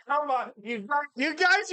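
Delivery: rising complaint. Real, not acted.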